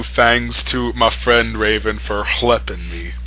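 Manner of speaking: usual speaking voice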